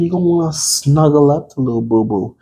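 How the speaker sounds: ordinary delivery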